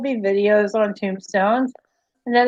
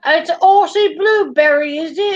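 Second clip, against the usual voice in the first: hammed-up mock Australian accent; bad Aussie-British accent; 3 semitones higher, 3 dB louder than usual. Joking about muffins on stream, bad Aussie-British accent. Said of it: "worst British slash Australian".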